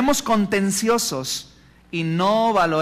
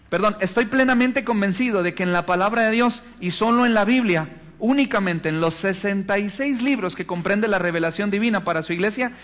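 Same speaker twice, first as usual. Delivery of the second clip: ordinary talk, nothing marked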